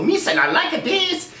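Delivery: high, laughing caveman talk; mock a dimwit in a high laughing voice; a guy praising the film, voiced as a high laughing dimwit; dumb guy parody. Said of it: "they just had a guy go".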